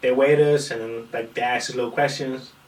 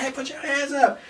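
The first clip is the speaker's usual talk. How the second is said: much higher cheering yell; crowd yelling; 13 semitones higher than usual. Retold or imitated. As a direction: shout up from the crowd. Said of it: "Everybody's like".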